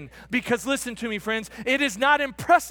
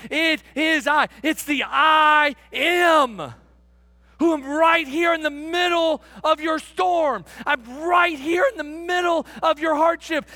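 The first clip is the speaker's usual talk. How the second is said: loud, raised-pitch preaching fervour; really excited; 3 semitones higher, 4 dB louder than usual. Real, not acted. Preacher to congregation about Jesus in the storm, really excited. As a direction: proclaim it with mounting excitement. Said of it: "I'm getting really excited".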